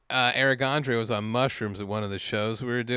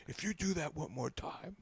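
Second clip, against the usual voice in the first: hushed, unvoiced retold warning; whispered threat; 15 dB quieter than usual. Imitating a man standing up to warn a comic, whispered.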